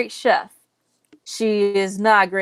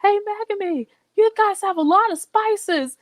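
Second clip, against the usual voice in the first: breezy Soma; 13 semitones higher than usual. Retold or imitated. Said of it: "Soma's just like".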